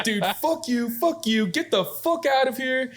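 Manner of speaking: high, cracking angry yell at the customers; furious yelling. Retold or imitated. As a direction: tell them to get out, fully losing it. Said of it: "that's when I fucking freaked out".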